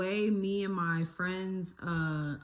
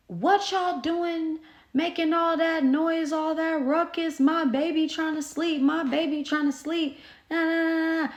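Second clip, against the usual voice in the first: imitated angry neighbor; 10 semitones higher, 3 dB louder than usual. Neighbor tells off noisy kids, mock furious rant. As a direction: rant like a neighbor going ham. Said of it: "she was going ham".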